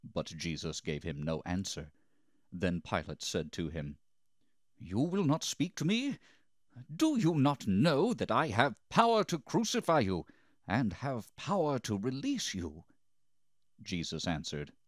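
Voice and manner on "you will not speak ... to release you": high-pitched, insistent questioning; incredulous demand. A narrator's reading. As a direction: press a silent prisoner with your authority. Pilate demands answer from Jesus.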